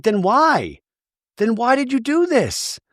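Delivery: high-pitched imitation of a wounded daughter; retold upset questioning; acted hurt demand. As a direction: plead for an answer, pitch climbing. Voicing hurt daughter questioning her mother.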